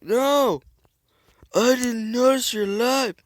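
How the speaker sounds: high, loud surprised protest; shocked loud squeal; startled outburst. Real, not acted. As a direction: blurt it out caught off guard. Surprised at a survivor.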